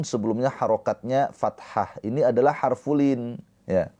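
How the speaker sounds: usual speaking voice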